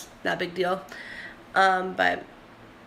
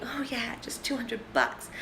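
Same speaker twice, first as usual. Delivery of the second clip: breathy whisper of a thought, softer; 2 semitones higher, 7 dB quieter than usual. Retold or imitated. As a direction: whisper it to yourself, casually. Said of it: "I'm opening it thinking".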